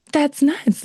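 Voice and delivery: high, loud, incredulous exclamation; excited disbelief. Retold or imitated. Contestant reacting to staff praise with high, loud disbelief. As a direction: throw it out in disbelief. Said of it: "I was like".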